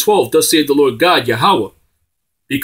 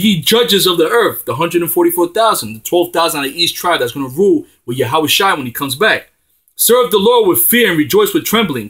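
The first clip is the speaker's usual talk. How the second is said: pulpit proclamation; 4 semitones higher than usual. Acted. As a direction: call out a warning to the kings. Proclaims psalm loudly.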